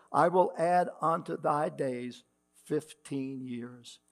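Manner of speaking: measured, ringing King James reading; scripture reading. Acted. Pastor reads Isaiah to the standing congregation, solemn reading voice. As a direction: read the old words out to the congregation with weight.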